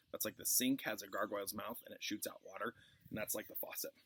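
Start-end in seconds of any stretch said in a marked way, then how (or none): none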